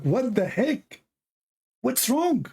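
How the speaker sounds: high, slow, stunned what the heck; baffled outrage. Retold or imitated. Baffled at the chat rooms, high stunned. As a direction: pitch it up in disbelief.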